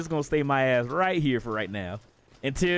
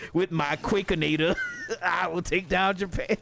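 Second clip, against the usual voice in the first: Nova; 3 semitones higher than usual. Pretend. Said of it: "Nova just sitting there, excellent, rubbing his fingers together".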